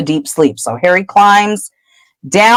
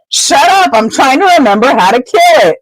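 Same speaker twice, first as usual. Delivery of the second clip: snappish retort; 7 semitones higher than usual. Acted. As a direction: shut him up, impatient. Hermione snaps back.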